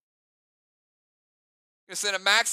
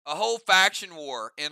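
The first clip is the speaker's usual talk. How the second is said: normal talking voice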